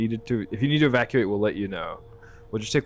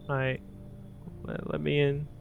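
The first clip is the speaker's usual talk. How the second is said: attempted little girl; 6 semitones higher, 6 dB quieter than usual. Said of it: "I can't do a little girl voice".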